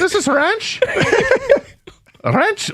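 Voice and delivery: very high, laughing put-on voice; mock astonished Brit. Mocking an English fan tasting ranch, hammed-up high voice. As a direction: ham up a tourist gawping at ranch.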